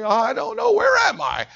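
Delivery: high, rising to a shout; groggy high cry. Retold or imitated. Patient waking from surgery, crying out to mom and grandma. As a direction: come up confused and alarmed.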